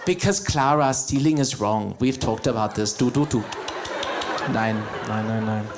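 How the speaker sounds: low-pitched parental lecture, playfully acted; mock scolding parent. Parent explaining to Clara why stealing is wrong, mock scolding. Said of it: "teaching their little children life lessons".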